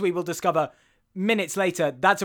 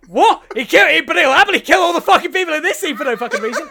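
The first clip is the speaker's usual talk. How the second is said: loud, high, fast rant breaking into laughter; incredulous outburst; 10 semitones higher, 8 dB louder, faster than usual. Real, not acted. Co-host railing at senseless film killings, incredulous shouting. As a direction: erupt in disbelief, racing through it.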